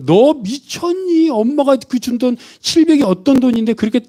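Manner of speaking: high-pitched acted scolding; angry sister. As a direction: play an outraged sister telling off her sibling. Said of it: "막 화를 냅니다". (언니가 화내며 야단치듯 높은 목소리로)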